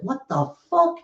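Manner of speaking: high startled what the fuck; shocked outburst. Retold or imitated. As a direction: retell the shock, pitch jumping way up. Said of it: "I was like".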